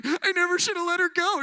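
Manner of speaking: high, hammed-up heartbroken wail; mock an overwrought friend in his feels; emotional friend. Friend on a late night call, mock emotional outburst. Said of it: "they're, like, emotional".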